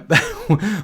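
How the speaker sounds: high laugh breaking out mid-story; real laugh spilling over; bursting laugh. Real, not acted. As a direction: crack up remembering the scene. Laughing at the Tommy Boy memory.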